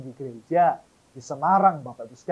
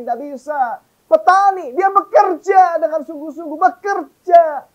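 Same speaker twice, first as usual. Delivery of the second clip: higher, louder fiery sermon; loud, rising pulpit preaching; full-force preaching; 11 semitones higher, 9 dB louder than usual. Acted. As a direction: drive it home to the congregation.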